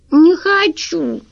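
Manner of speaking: put-on high, slow stubborn child's refusal; little girl refusing to say hello; sulky little girl. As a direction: refuse like a pouting kid. Girl refuses to greet guest.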